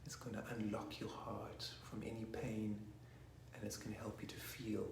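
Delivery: soft breathy whisper. Real, not acted. Telling listeners the ah sound unlocks the heart, in a whisper. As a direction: hush it as a secret.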